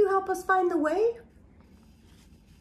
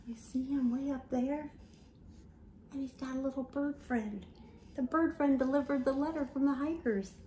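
put-on softer, secretive tone to the children; hushed aside; 9 dB quieter than usual. Hushed asides about the picture.

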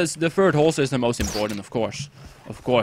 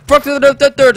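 loud high burst of frustration; panicked yell; 9 semitones higher, 9 dB louder than usual. Real, not acted. Gamer yelling at his screen as the deer escapes, panicked shout. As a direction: blurt it out in a panic, much higher.

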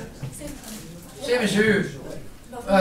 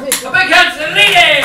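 calling out; 4 semitones higher, 7 dB louder than usual. Real, not acted. Comic calls crowd to applaud.

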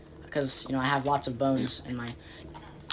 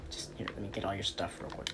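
sneaky whisper; 5 semitones lower, 17 dB quieter, faster than usual. Pretend. Sneaking up to kill villager.

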